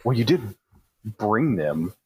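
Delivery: usual speaking voice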